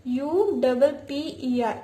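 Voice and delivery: slow letter-by-letter spelling; spelling aloud. Shown as a demonstration. Teacher spelling a word for young students, slow and clear. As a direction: spell out each letter slowly for the class. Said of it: "upper part".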